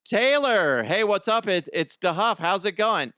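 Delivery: high and loud, eager welcome; excited greeting. Acted. Host greets pretend [name] on the phone, excited welcome. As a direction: greet the star like a thrilled fan.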